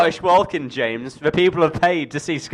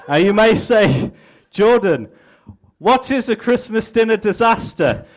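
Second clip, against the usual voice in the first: lightly raised, hammed-up politician's pitch; mock campaign speech; 8 semitones higher than usual. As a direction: sell it like a smug candidate at a rally.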